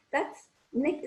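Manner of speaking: normal talking voice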